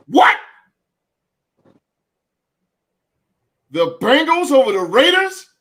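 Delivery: can't believe that pick; baffled surprise. Real, not acted. Host reading a shocking pick, loud baffled surprise.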